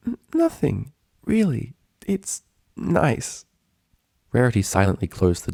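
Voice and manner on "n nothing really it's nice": high, meek stammer; much higher, nervous and small; timid Fluttershy. Acted. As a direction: fib sweetly and shyly. Fluttershy voiced in story.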